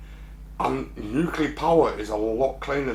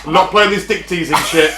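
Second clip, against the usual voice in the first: laughing mock outrage; 8 semitones higher, 11 dB louder than usual.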